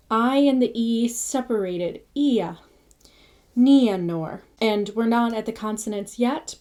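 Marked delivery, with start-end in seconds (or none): 3.6-4.4 Nianor, final R rolled; NEE-a-nor with trilled R. Shown as a demonstration. Teacher modelling Nianor with a rolled R. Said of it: "R is always rolled".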